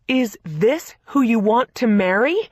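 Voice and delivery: loud, slow, furious question; shouting. A narrator's reading. Sister on the phone at her wits' end, shouting. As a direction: yell it at her in disbelief. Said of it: "Victoria was shouting".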